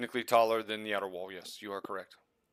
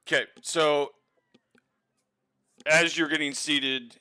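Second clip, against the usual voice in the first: usual speaking voice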